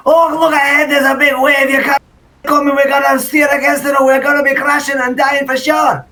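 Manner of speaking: put-on ridiculous Scottish accent. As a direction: ham up a silly Scottish sailor yelling a warning. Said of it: "So in his accent, he's gonna say".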